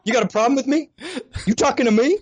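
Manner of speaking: high-pitched mock confrontational voice; mock tough guy. Guest teased as master of strife, mock tough-guy challenge. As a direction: play at picking a fight.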